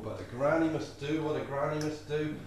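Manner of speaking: ordinary delivery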